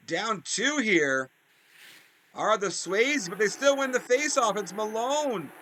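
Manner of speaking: lively hockey commentator calling the action; play-by-play announcer. Acted. Gamer commentating his hockey game to viewers in announcer voice.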